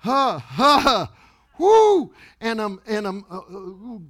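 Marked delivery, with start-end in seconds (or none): 0.0-2.1 loud, high forced "ha" bursts into a whoop; acted-out laughing fit. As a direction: re-enact uncontrollable laughter, ending in a whoop. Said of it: "I'm laughing".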